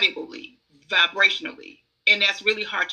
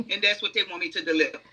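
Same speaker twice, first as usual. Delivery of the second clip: ordinary delivery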